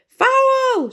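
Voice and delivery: high, loud outraged cry; angry shout. A narrator's reading. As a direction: yell for a foul. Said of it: "shouted the goalkeeper".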